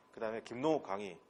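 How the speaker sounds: usual speaking voice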